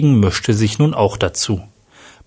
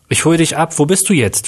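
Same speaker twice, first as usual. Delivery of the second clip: higher, urgent, mildly shocked; 6 semitones higher than usual. A narrator's reading. Tim asks Manuel where he is, mildly shocked. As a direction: narrate with contained alarm, pitched up. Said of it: "fragte Tim schockiert".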